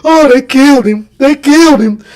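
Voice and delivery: high, loud, grief-stricken cry; mother crying out that Marvin was killed. Retold or imitated. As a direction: sob out the news in disbelief. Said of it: "And she was crying".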